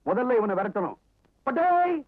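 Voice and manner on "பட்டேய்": shooing call. Acted. Shooing him away.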